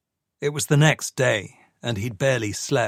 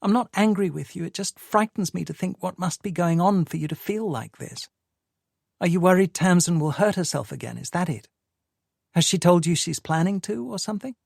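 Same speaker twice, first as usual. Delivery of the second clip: a higher, brisk worried wife; lightly voiced woman, quick and fed up; Sadie's voice; 7 semitones higher than usual. A narrator's reading. Sadie confronting Michael about his state, higher and quicker.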